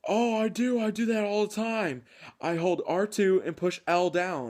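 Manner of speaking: mock clueless opponent. Mimicking the opponent who insists he does it, high and dopey. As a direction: play him as a clueless whiny gamer. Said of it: "He goes".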